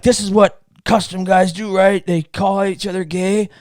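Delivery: mock intense. Imitating himself new to custom collecting, mock intense. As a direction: play the overeager newcomer, voice up. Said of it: "I'm all intense".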